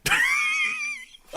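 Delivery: shrieking high laugh; a laugh that cracks up high; helpless shrieking laugh. Real, not acted. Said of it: "Oh, it just kills me".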